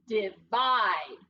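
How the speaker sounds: "divide" slowly with a short first i, as dih-VIDE. Shown as a demonstration. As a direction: stretch "divide" as dih, then a stressed VIDE. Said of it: "Breve or schwa?".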